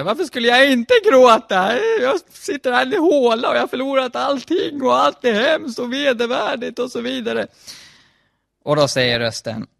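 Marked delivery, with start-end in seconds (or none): 0.0-7.5 high, wailing mock crying voice; hammed-up sobbing complaint, pitched way up; crying, tearful protest. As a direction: wail it out like someone in tears. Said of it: "han grät sig till sömns".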